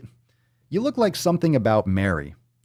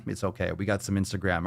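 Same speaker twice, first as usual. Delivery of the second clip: ordinary talk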